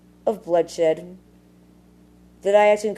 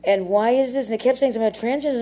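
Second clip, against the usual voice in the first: ordinary delivery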